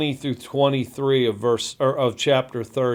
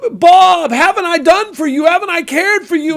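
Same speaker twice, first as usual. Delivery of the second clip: high, louder, pleading reproach; Job pleading with God; 6 dB louder than usual. Retold or imitated. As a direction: demand an answer, hurt and pleading. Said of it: "And he says".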